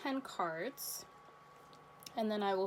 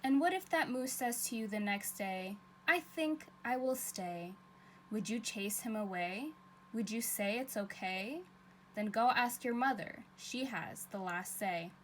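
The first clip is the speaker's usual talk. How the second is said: put-on lilting storybook read-aloud for kids; reading to children; 4 dB quieter than usual. A narrator's reading. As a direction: read it like story time for little ones. Teacher reads picture book aloud.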